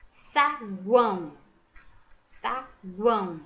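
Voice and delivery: "Saguão" slow, split into sa and guão; "Saguão" by syllables, U voiced; U sounded out. Shown as a demonstration. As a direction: stretch "Saguão" with a clear gw glide.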